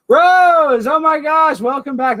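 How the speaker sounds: high, loud cry of welcome; excited welcome. Real, not acted. Host greeting Rose back after her scene, a loud high welcome. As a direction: call out to Rose with delight.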